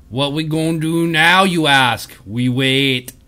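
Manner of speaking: put-on half-hearted Jamaican and Arnold troll accent; a hint of Jamaican-Arnold troll accent; troll accent mixing Jamaican and Arnold. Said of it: "a mix of, like, Jamaican and Arnold".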